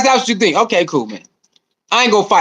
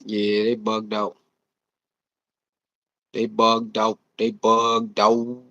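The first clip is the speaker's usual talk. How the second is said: far off; 13 semitones lower, 10 dB quieter, much slower than usual. Real, not acted. Stream audio glitch.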